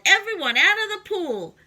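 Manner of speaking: high, loud command; yelled order. Acted. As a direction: clear the pool with a shout. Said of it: "The firemen yelled".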